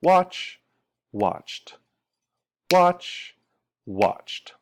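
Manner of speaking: "watched" in one beat, like "watcht"; one-syllable T ending. Shown as a demonstration. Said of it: "Still one syllable".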